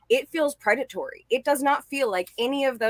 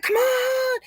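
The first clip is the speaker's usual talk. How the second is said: mock exasperated guy. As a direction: shout it like a fed-up guy. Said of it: "the guys are like".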